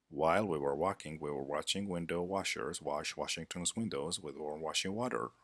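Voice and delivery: fast tongue-twister recital; quick, even articulation exercise. Shown as a demonstration. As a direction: rattle through it quickly as a diction drill. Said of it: "Let's see more tongue twister".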